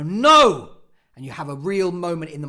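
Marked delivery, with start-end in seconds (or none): 0.0-0.8 loud, high dramatic cry; climactic outburst. Acted. Actor at the monologue's real moment, loud high outburst. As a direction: burst out at full force on one word. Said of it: "there's a moment where you go".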